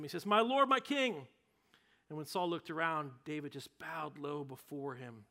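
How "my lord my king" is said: shouted call. Acted. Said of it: "David came out and shouted to him".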